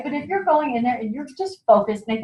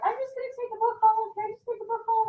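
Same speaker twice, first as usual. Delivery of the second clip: high, quiet, timid mock customer; imitating hesitant guest; 12 semitones higher, 7 dB quieter than usual. Consultant mimicking a guest who only wants a book, high and soft. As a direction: shrink into a noncommittal guest, pitched up. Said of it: "this person's like".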